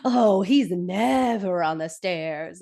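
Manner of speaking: slow, drawn-out NEVER on the stairs; exaggerated slow denial, NEVER held long; LaDonna. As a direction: stretch out "never" and "stairs" in mock insistence. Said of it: "And LaDonna's like".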